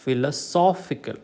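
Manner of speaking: philosophical said slowly as philo-SOPH-ical; stress on SOPH. Shown as a demonstration. Teacher showing third-from-last stress, slow philo-SOPH-ical. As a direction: put the weight on SOPH in philosophical. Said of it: "third syllable from the last".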